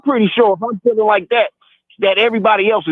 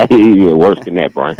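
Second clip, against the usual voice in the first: laughing tease; 12 semitones lower, 5 dB louder, slower than usual. Real, not acted.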